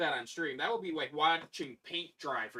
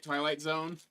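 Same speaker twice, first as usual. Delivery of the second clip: ordinary delivery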